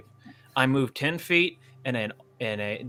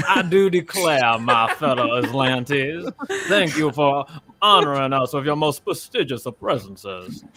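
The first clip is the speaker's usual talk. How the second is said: loud, slow Southern gentleman drawl; 5 dB louder than usual. Acted. Player mocks a captain addressing his fellows, loud Southern gentleman accent. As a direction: play a grand, courtly Southern gentleman. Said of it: "southern gentleman".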